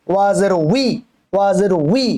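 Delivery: "উই" as wi, starting on rounded lips; rounded w onset. Shown as a demonstration. Teacher shows the correct waw, clear wi sound. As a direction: glide from w into a long i on "উই". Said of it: "ওয়াজের ই না".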